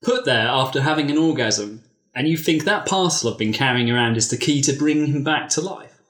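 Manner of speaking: steady storytelling read; reading a racy story aloud to friends; story narration. Acted. Reads erotic story aloud.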